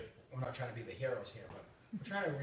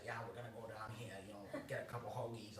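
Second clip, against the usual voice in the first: put-on Boston accent; 3 semitones lower, 9 dB quieter, faster than usual. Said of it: "in the Boston accent's kinda like, ... but a little deeper".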